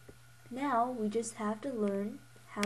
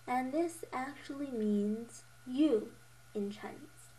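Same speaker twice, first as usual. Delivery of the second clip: usual speaking voice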